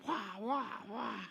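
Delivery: high, fake toddler wailing; mock baby crying. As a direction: mimic the kids whining at the table. Imitating crying kids.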